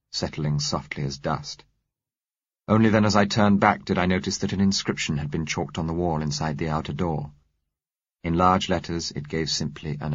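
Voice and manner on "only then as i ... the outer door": usual speaking voice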